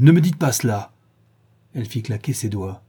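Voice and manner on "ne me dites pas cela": loud, higher-pitched protest; crying out. A narrator's reading. Dorian orders the mocking woman to stop, crying out. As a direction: cry out in protest, voice raised. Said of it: "cria-t-il".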